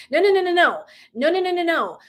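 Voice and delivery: emphatic no. Real, not acted. Fire off the noes, high and loud. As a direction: coach shutting down a lukewarm wish, emphatic. Coach rejecting half-hearted wishes.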